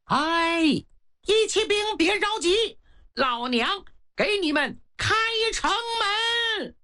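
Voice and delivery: high call from the city wall; woman calling down. A narrator's reading. The witch on the wall calls down to the attackers, high bold call. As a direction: shout down boldly to the troops below. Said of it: "这个女的冲着城下喊".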